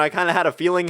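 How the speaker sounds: ordinary talk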